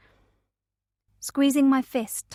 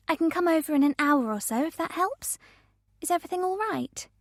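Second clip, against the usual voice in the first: concerned friend; 6 semitones higher, faster than usual. A narrator's reading. Naomi concerned on the phone.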